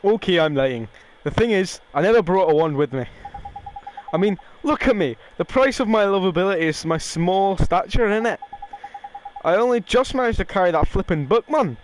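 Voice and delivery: wobbly, badly faked Irish accent; muddled accent. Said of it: "don't even know what accent I'm doing".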